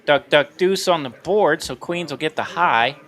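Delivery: ordinary talk, nothing marked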